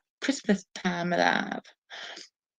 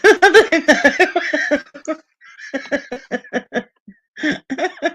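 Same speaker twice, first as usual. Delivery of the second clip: giggling, pitched well up; laughing; 10 semitones higher, 5 dB louder, much slower than usual. Real, not acted. Answering a quiz wrong, giggling. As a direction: laugh through the words.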